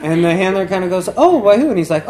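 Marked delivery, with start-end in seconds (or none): 1.2-1.7 high, loud, mock-astonished question; eager surprise. Handler hearing the prince is in love, eager surprise. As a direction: jump up in pitch and volume.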